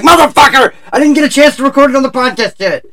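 high, loud indignant yell; hammed-up cry of frustration; retold outburst. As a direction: shout in mock outrage, high-pitched throughout. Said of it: "and I was like".

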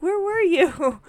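put-on loud, high-pitched child wail; imitating upset niece. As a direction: cry out like a kid missing auntie. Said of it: "She's like".

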